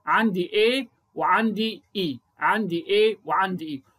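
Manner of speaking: A and E stretched out slowly; slow and stressed. Shown as a demonstration. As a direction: spell out A and E deliberately. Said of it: "وأوضح الحرفين المتحركين". (ببطء مع تأكيد على A و E)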